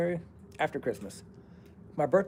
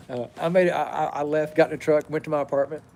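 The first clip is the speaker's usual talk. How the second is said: usual speaking voice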